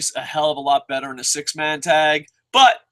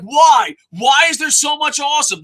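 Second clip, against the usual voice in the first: high, loud overjoyed "why"; joyful exasperated yell; excited shout; 9 semitones higher, 4 dB louder than usual. Retold or imitated. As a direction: cry out at too much awesome. Overwhelmed by good wrestling.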